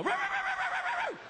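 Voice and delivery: very high yapping puppy imitation; rapid shrill cartoon dog yaps; puppy barking. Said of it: "puppy would sneak up behind and go".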